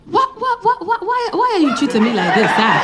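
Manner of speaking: put-on high, pleading African accent, breaking into laughter; African guy's pleading voice note. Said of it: "this is what the voice note says".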